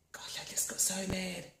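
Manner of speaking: breathy regretful whisper to herself. Acted. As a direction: whisper it like a private confession. Self-talk during tapping.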